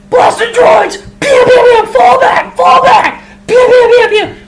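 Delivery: high, loud battle shout; shouting orders over battle. Acted. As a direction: yell orders over the blaster fire. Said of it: "this shout".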